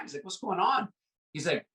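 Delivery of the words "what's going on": high-pitched mock bewilderment; retold baffled question, pitched up; puzzled visitor. Visitor asking friend about the dog, mock bewildered.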